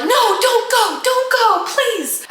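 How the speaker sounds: high-pitched pleading wail; desperate mock plea. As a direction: beg them not to leave, hammed-up and high. Friend blocks door, begging to stay.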